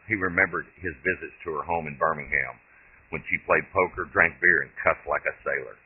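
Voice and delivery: ordinary delivery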